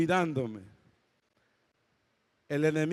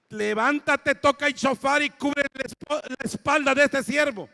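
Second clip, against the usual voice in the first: commanding call; 9 semitones higher, 4 dB louder than usual. Retold or imitated. God's command retold in dream.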